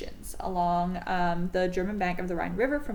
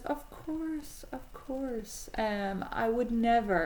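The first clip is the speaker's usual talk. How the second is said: much softer, oily mock reassurance; sly false promise; 3 dB quieter, much slower than usual. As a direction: purr it like a liar promising innocence. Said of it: "And Hitler says".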